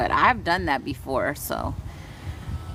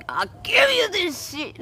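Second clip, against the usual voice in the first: high, hammed-up yell of release, half laughing; mock angry outburst; 13 semitones higher, slower than usual. Healer acting out how she vents pain to viewers, mock yell. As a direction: fling it out like a playful tantrum. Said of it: "my best moments when I'm like".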